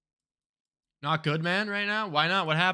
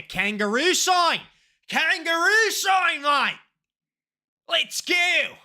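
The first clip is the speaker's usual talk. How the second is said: loud, high, drawn-out celebration; whooping Australian-accented cheer; excited shout; 11 semitones higher, 7 dB louder, slower than usual. Real, not acted. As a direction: yell it out in Aussie triumph. Celebrating spotting a kangaroo sign.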